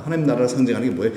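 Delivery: ordinary delivery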